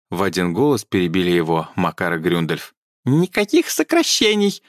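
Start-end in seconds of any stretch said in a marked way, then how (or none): 3.0-4.6 high, shrill elf shriek. A narrator's reading. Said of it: "взвизгнул собеседник".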